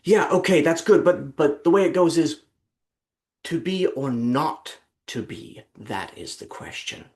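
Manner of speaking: mock Cumberbatch showing how it goes; imitating Benedict Cumberbatch. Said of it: "he says".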